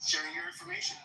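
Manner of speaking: high mocking "information" with a rise; "information" sung out high and rising; taunting sing-song.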